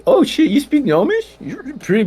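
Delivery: high excited gnome outburst; a gnome's gleeful surprise; surprised. Acted. Surprised at Gnomish.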